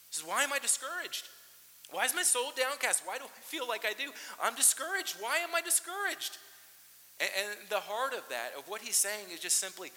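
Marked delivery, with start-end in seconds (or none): 0.2-6.4 loud, high, rising why; anguished questioning. Retold or imitated. Pastor voicing the psalmist to the congregation, anguished loud questions. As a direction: press the question harder each time.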